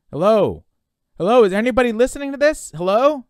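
loud, high, urgent call into a mic; high-pitched shouted hellos; calling out for anyone. Acted. Calling out into a radio microphone.

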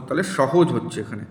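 ordinary talk, nothing marked

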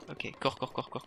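hushed cours; hushed urging. Real, not acted. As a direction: urge yourself on under the breath. Fleeing guard unseen.

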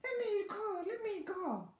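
high piglet squeal; struggling piglet's squeal; squealed plea. A narrator's reading. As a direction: plead in a high little voice. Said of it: "The piglet kicked and squealed".

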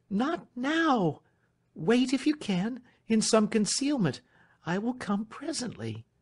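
very high voice, easing at the end; Marian, urgent young lady. Acted. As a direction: firmly put him off, high and anxious.

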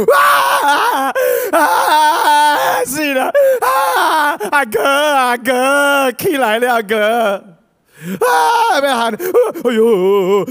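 very high, loud mock wailing and shouting; hammed-up funeral lament calling the brother; wailing mourners. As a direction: howl like grieving relatives.

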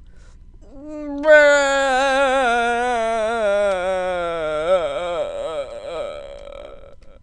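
long drawn-out fake bawl; hammed-up wail that sinks and breaks up at the end; mock crying. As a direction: bawl theatrically on one long breath. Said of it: "Best cry ever".

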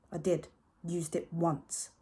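plain reading voice

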